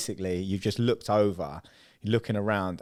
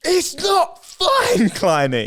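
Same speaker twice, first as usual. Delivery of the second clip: retold shout; 8 dB louder, much slower than usual. Retold exasperated reply.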